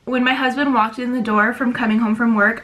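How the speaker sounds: normal talking voice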